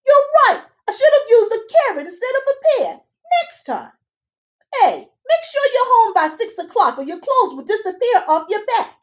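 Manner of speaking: high, chirpy little fairy man; fairy godfather. Acted. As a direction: squeak it out like a tiny busy fairy. Fairy godfather warns Sidney.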